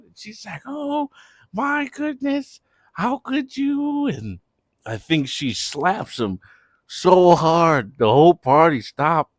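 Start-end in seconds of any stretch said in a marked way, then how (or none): 0.7-4.1 very high, slow hurt voice; mock outraged girlfriend. Girlfriend hurt at Breeze, slow high mock voice. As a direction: drag out the betrayal, way up high.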